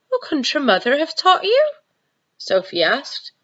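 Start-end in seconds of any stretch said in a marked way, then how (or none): 0.1-1.7 put-on higher curious girl; little girl asking gently; young girl voice. Sophie asks, high.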